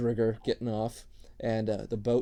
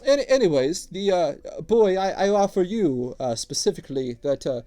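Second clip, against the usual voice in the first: higher, louder wheedling dwarf voice; gruff dwarf tattooist; 6 semitones higher, 8 dB louder than usual. Acted. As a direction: sell your wares like an eager old dwarf. Dwarf keeps talking.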